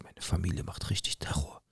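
hushed secret whisper at the window; whispered so the family won't hear. Retold or imitated. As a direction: breathe it out, no voice at all. Girlfriend secretly talking at window.

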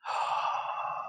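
long audible inhale; deep breath. Acted. Olivia before blowing out candles, big deep breath. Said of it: "She took a deep breath".

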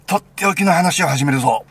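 high, booming kickoff to a tale; loud announcement. Acted. Man launching his special story for an old friend, loud announcement.

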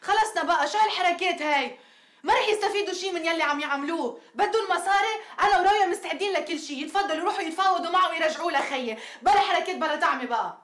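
rising angry outburst; exasperated. Acted. Samra fed up with her aunt's news, angry outburst. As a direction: vent hard at their games. Samra furious at kidnappers' tactics.

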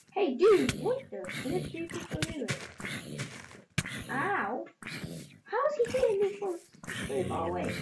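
high, loud, startled protest; indignant outburst. Real, not acted. Gamer yelling at an attacker hitting him, indignant. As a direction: protest in high-pitched indignation at being hit. Gamer suddenly hit by an enemy.

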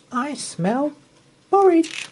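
put-on high, rising little girl's sniffing delight; little girl Goldilocks. Goldilocks voiced in story.

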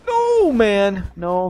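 high, loud, stretched cry; alarmed protest. Real, not acted. Player refusing to get caught, alarmed high cry.

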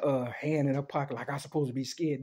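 ordinary talk, nothing marked

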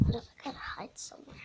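quick hushed whisper. Real, not acted. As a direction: whisper it fast, hiding. Hiding from approaching person.

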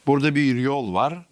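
ordinary delivery

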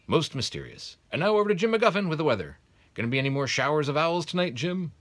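high, loud, cheerful handover to the weatherman; grinning newscaster. Acted. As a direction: tease the weatherman with a grin. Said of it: "The newscaster allowed himself a grin".

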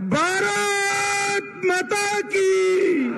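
high, long drawn-out rallying cry; thunderous slogan call. Real, not acted. Speaker leading the crowd in a slogan, high drawn-out call. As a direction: stretch each word out to lead a chant. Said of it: "प्रचंड आवाज से बोलिए".

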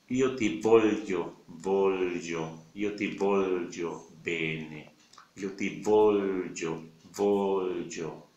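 io ti voglio, gli in voglio stressed and held; slow io ti voglio, marking the gli; marked Italian gli. Shown as a demonstration. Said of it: "lo marca más". (io ti voglio lento, marcando la gli)

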